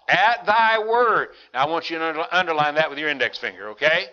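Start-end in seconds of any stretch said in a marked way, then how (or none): 0.1-1.3 "At thy word." slow and high, every word leaned on; solemn emphasis. Shown as a demonstration. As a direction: lift and stretch "At thy word." reverently. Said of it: "Caught himself, didn't he?".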